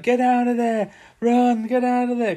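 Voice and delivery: high, loud shout from afar; shouting warning. Retold or imitated.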